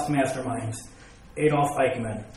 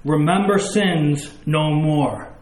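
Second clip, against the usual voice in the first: Remember sins no more, said slowly with "Remember" leaned on hard; emphatic, slow stress; slower than usual. Shown as a demonstration.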